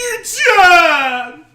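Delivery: slow, high, drawn-out shout; loud, stretched Majima-style yell; Majima's call. Retold or imitated. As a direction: sing out the name like a wild gangster calling his rival. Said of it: "Majima's call to Kiryu".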